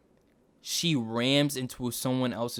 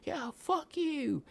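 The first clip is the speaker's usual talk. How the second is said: mock yell; 13 semitones higher, 3 dB quieter, much slower than usual. Angry woman yelling at people.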